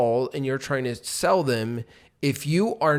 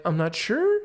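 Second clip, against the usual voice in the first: higher, "sure" lifted like a question; upward inflection; 6 semitones higher than usual. Shown as a demonstration. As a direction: sound unsure, rising at the end. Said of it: "And you're going up".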